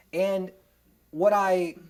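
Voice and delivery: ordinary talk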